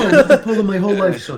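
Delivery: hammed-up Polish dad accent through laughter; old Polish man. Joking about claiming he grew up in Poland, old Polish man. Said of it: "talking like an old Polish man".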